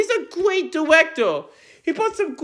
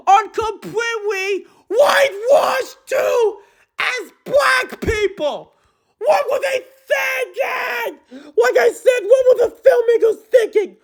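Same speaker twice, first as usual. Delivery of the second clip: high, exasperated yelling; real yelling; 5 semitones higher than usual. Real, not acted. Fan ranting at the movie, real outraged yell. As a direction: rant at the film in outrage. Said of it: "I need to stop yelling".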